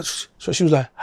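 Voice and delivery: usual speaking voice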